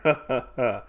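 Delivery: mock villain chuckle; short rhythmic put-on laugh; acted gloating laugh. Gambler pleased with his scheme, acted gloating chuckle.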